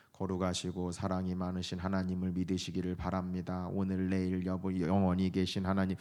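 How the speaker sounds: low, soft monotone preaching; stiff, unvarying pious recitation; uniform sermon tone. Shown as a demonstration. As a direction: drone it out on one low note, like a formulaic sermon. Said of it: "일 년 내내 이렇게 설교하지 않잖아요".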